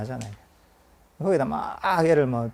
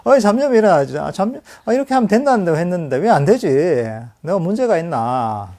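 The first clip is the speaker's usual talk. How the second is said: frustrated self-doubt; 3 semitones higher, 8 dB louder than usual. Retold or imitated. Meditator fretting at their own wandering mind, frustrated and loud. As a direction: whine at yourself for failing to focus. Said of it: "이렇게 하면서 마음속에서 불만족감을".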